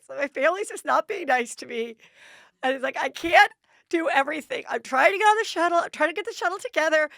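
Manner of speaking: blubbering out her grievances; overwhelmed mom venting while crying; crying rant. Retold or imitated. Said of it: "Now I'm just off crying".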